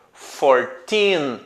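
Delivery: put-on fourteen slowly, with a long "teen"; "fourteen" drawn out on teen; stress on TEEN. Shown as a demonstration. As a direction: lean on the last syllable of "fourteen". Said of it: "com o teen na sílaba mais forte".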